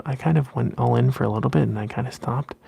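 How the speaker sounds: ordinary delivery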